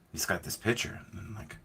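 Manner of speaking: normal talking voice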